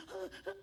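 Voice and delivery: mock frightened gasping; acted breathless panic; panicked gasps. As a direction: gasp in short bursts as if too scared to speak. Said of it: "no me hables con miedo".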